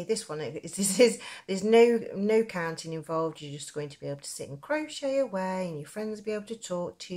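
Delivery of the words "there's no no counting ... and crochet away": ordinary delivery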